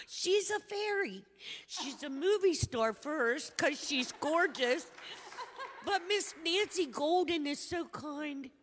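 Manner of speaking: gushing, laughing praise. Real, not acted. Praising Nancy Golden to the congregation, loud and laughing. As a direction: gush about her like she's a movie star. Gushing about a beloved friend.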